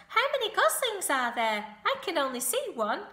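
high, loud, eager questioning; excited shouting. A narrator's reading. Wilbur trying to count the new goslings, excited shouting. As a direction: call out eagerly, pitched right up. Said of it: "shouted Wilbur".

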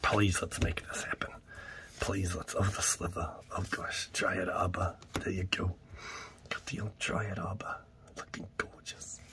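hushed awe at the pull; whisper. Acted. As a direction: whisper a wish over the pack. Whispered pleading and reveal.

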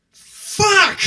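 sudden shrieked curse, high and loud; panicked yell. Retold or imitated. Dad panicking at the school tag table, a sudden high shriek. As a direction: blurt it out in total panic. Panicked outburst at name tags.

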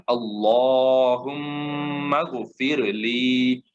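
slow recited আল্লাহুম্মা গফিরলি with heavy lam; Quran recitation. Shown as a demonstration. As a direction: chant it slowly like tilawat. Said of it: "মোটা করে পড়ছেন".

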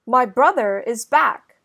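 plain reading voice

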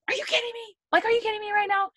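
shrill, high-pitched outraged cry; mock outrage at a driver who cut her off; mad and angry. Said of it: "I'm, like, mad and angry".

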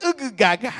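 high pretend baby gurgle; playful infant noises; baby babble. Shown as a demonstration. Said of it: "el bebé le tiene que ser".

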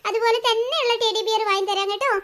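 ordinary delivery

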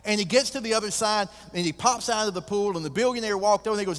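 high, loud storytelling at full force; excited preaching. Acted. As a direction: build the crowd's excitement over the swim. Preacher acts out the joke climax.

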